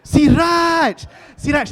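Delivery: high, loud shout of his name; wife yelling. Retold or imitated. Wife yells for her husband, high exasperated shout. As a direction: shout to grab his attention, mock exasperated. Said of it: "She's just like".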